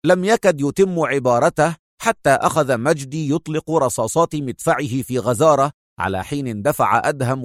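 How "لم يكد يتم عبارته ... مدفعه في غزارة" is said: ordinary delivery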